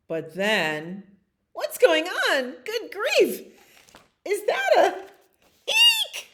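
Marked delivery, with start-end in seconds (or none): 1.5-6.3 high, startled exclamations; squealing, comic alarm; alarmed crowd. Acted. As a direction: cry out in mock panic. City people alarmed at cow.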